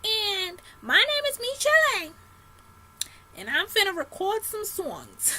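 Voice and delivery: very high, thin squeaky voice. Retold or imitated. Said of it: "she talks like this".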